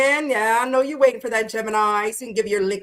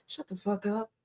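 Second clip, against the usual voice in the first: hushed, much softer mock retort; impatient inner voice; 12 dB quieter than usual. Leo side silencing the patient moon side, impatient hushed retort. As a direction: cut off the nagging under your breath. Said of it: "my Leo side's like".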